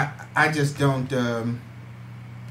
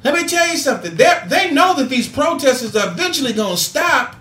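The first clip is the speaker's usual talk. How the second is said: higher, driving preacher's cadence building up; impassioned call to action; preaching; 7 semitones higher than usual. Acted. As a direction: fire up the audience like a Sunday sermon. Rises into preaching to listeners.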